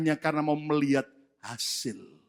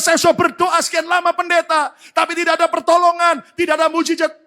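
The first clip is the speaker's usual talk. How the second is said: mock complainer; 11 semitones higher, 11 dB louder than usual. Pleading churchgoer voiced.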